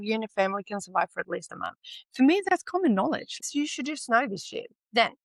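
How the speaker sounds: ordinary talk, nothing marked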